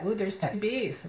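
ordinary delivery

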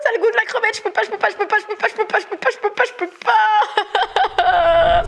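voix suraiguë, mots répétés en boucle; panique rieuse. Real, not acted. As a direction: protester très haut, dernier mot étiré. Panique rieuse au goût de crevette. (affolement aigu au bord du rire)

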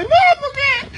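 high, slow wailing cry of a daughter; grito de hija. Retold or imitated. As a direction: wail it like a grieving daughter. Mujer grita llorando por el padre. (hija gritando ay papá con voz aguda)

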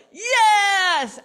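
loud, high yelled yes; crowd roar. Retold or imitated. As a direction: roar back. Crowd answers.

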